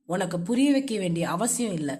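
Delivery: low, dismissive husband; curt, uncaring and low; indifferent. A narrator's reading. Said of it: "விட்டேற்றியாக பேசினான்".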